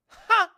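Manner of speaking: sudden high, loud single "ha"; one loud, high barked laugh; mock laugh. Woman imitating the guy who laughed in the movie, mock laugh. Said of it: "would just laugh like this".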